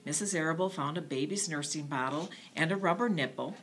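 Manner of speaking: ordinary delivery, nothing marked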